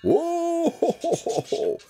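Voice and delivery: high, drawn-out "whoa"; long high call to stop a sled; yelled whoa. Acted. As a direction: yell it long and high, like halting reindeer. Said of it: "And he yells".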